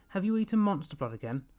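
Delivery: angry cry. A narrator's reading. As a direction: tell the dog off, loud and high. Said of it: "Evan cried angrily".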